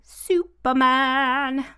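slow, drawn-out hero's name; heroic announcement. Pretend. Echoing co-host's answer, playfully drawn out and grand. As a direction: stretch it out like a superhero title.

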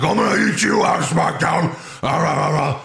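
hammed-up threatening roar; mock menace trailing into rah-rah noise; mock threatening wrestler. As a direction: imitate a big wrestler's threat. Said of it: "threatening Gable and Otis. He's like".

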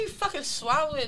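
ordinary talk, nothing marked

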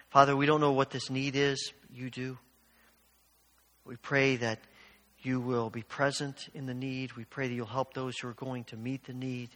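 low, soft spoken prayer. Real, not acted. Pastor praying aloud as a siren passes, soft and low. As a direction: pray gently for strangers in need.

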